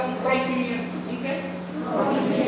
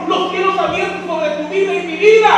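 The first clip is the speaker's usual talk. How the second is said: loud, higher preaching cry; preaching shout; 6 semitones higher, 7 dB louder than usual. Real, not acted. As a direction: proclaim it at full force. (grito de predicación)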